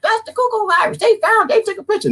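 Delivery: high falsetto imitation; hammed-up high mocking voice; mocking gullible viewers. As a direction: squeal it like a credulous news watcher. Mimics gullible news watchers.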